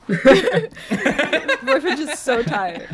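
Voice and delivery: laughing through the words; giggling while speaking. Real, not acted. Joke about the dead-looking boyfriend.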